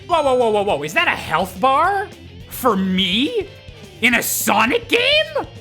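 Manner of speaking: high, rising disbelief; mock astonishment. Mock astonishment at a health bar.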